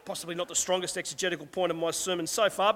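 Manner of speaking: ordinary talk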